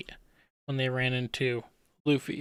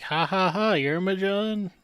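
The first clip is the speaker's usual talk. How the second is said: higher, mock-boastful laugh into a question; cocky villain; 6 semitones higher, 3 dB louder than usual. Blackbeard running into Magellan, cocky and laughing. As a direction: crow it like a cocky pirate. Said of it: "being a cocky guy".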